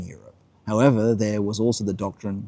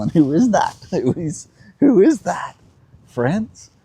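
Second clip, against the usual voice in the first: higher, excited quiz-master lilt to the viewers; playful teasing question; 8 semitones higher than usual. Real, not acted. Host teasing friends on the stream with an excited rising question. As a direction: tease the audience with a high, bright question.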